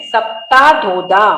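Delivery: सप्ताधोदा slow, ता held long; long-vowel pronunciation. Shown as a demonstration. Teacher modelling the word with its vowel mark, drawn-out सप्ताधोदा. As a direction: draw out every आ in सप्ताधोदा. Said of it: "काना मात्रा के साथ बोलना है".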